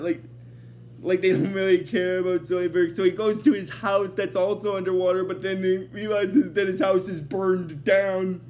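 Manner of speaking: usual speaking voice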